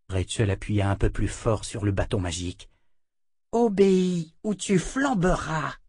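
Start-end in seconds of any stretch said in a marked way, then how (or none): 3.5-5.7 high, slow threat; menacing girl. Acted. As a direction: order it slowly in a high child's voice. Servant orders princess with magic stick.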